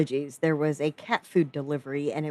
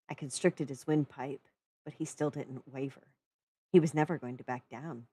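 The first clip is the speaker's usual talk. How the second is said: usual speaking voice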